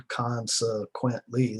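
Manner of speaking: Consequently drawn out slowly, every syllable clear; slow, deliberate. Shown as a demonstration. Teacher showing students a big word, slow and deliberate. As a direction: spell out Consequently slowly for the class. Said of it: "that's four syllables".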